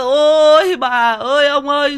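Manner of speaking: loud, high, drawn-out wailing cry; hammed-up keening, slow and loud; mock funeral wail. Storyteller imitating mourners crying for grandparents, mock wail. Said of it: "Thấy người ta khóc rồi".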